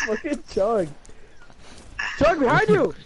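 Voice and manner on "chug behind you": fake alarm. Fake warning to teammate.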